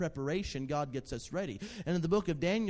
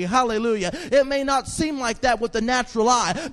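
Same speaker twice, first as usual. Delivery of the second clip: louder, rising exhortation; fervent preaching; 6 semitones higher, 10 dB louder than usual. Acted. Pastor urging the congregation to stand with authority, fervent preaching. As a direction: build the room up with conviction.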